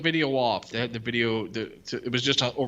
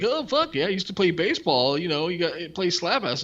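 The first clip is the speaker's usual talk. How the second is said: higher, hammed-up braggy dude voice; mock eager jock; 7 semitones higher than usual. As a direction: ham up a guy bragging about baseball. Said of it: "he's like".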